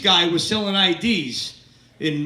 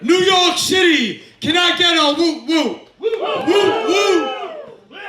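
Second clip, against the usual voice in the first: crowd hype shout; 8 semitones higher, slower than usual. Real, not acted. Comic greets the New York crowd, high drawn-out shout. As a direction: whip the room up with a big drawn-out yell.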